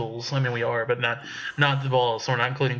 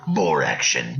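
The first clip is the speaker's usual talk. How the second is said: very low trailer announcer; deep booming trailer line; movie trailer voice; 13 semitones lower, slower than usual. Pretend. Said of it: "pull a little Pablo Francisco".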